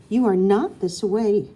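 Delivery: loud, slow furious words; angry hiss. A narrator's reading. Wife confronting her husband, loud slow hiss. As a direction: bite each word out at him. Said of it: "she practically hisses".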